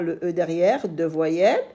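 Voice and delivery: normal talking voice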